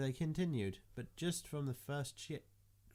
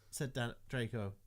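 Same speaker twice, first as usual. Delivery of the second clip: ordinary delivery, nothing marked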